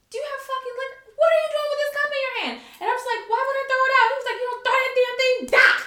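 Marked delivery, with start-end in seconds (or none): 0.1-2.5 high, fast incredulous telling-off; exasperated scolding. Retold or imitated. Boyfriend confronts her over a cup of liquor, exasperated scolding. 3.3-4.1 high, loud protest; indignant retort. Retold or imitated. Woman answers boyfriend's scolding about her drink, indignant retort. 4.4-5.8 high, loud telling-off; exasperated scolding. Retold or imitated. Boyfriend tells her off about the liquor, exasperated scolding.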